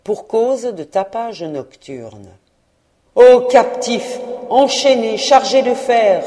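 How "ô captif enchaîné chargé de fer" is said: loud, high anguished cry; ghostly outcry. Acted. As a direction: wail it out like a chained ghost. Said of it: "s'écria-t-il".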